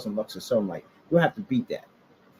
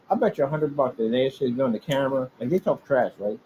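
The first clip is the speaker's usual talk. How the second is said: ordinary talk, nothing marked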